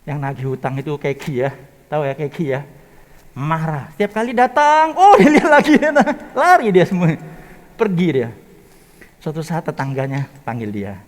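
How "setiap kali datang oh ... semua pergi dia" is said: raised, louder lively telling with a laugh; excited storyteller's burst; excited retelling. Retold or imitated. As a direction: tell it with growing glee. Creditor comes, debtor runs laughing.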